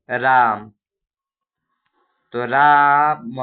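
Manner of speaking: राम held very long and loud; drawn-out Ram. Shown as a demonstration. Teacher showing prolonged vowel, loud and drawn. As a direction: call out राम, stretched slowly. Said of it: "राम तो ज्यादा समय लग रहा है".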